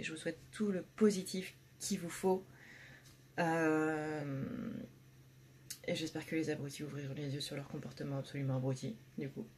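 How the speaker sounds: ordinary talk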